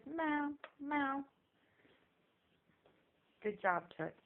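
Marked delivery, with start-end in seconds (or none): 0.1-1.2 kitty meow. Shown as a demonstration. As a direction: meow like a kitten for a baby. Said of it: "he says".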